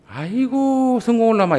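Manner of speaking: high, slow wailing scold; mock scolding grandma. Preacher imitating a grandmother scolding a child, high and slow. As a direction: cry it out like a fed-up Jeolla grandmother. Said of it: "이렇게 안 해요".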